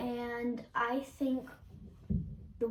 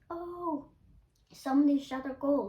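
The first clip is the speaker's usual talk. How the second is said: raised pitch, loud acted cheer; mock cheer, high "oh" then louder; good-natured cheer, imitated; 5 semitones higher, 7 dB louder than usual. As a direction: call out the goal approvingly, as an example. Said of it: "in a good way".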